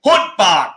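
Hutbart, short and blunt; firm Thai Hutbart, falling at the end; Hutbart said firmly. Shown as a demonstration. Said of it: "I've written it how you would say it".

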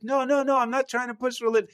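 high, hurried backpedalling; flustered denial. Retold or imitated. Younger self denies pushing religion to offended diner, flustered. Defends himself against accusation.

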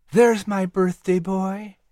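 put-on fond mother greeting her birthday boy; tender mother.

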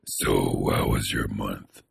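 very low, quiet deep voice; slow menacing rumble; deep creepy voice. Shown as a demonstration. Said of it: "don't ask me like that".

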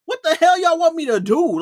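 loud, high-pitched exasperated protest; fed-up yell, easing off toward the end; mad outburst. Retold or imitated. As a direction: retell it as an indignant outburst, pitch jumping up. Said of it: "I'm getting mad in my head".

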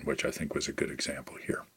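whispering; whispered aside. Real, not acted. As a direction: drop to a whisper. Trailing aside.

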